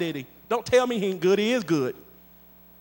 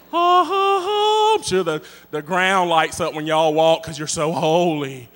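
sarcastic mock holiness; 4 semitones lower, 5 dB louder, slower than usual. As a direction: tease the holy folks. Mocking holier-than-thou listeners.

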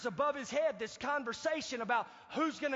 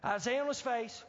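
ordinary talk, nothing marked

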